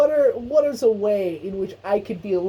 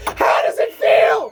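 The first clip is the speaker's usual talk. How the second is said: loud, higher mocking shout; shouting to rub it in; taunting shout; 11 semitones higher, 8 dB louder, slower than usual. Real, not acted. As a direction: yell it through the door to rub it in. Taunting Casey, who is locked out.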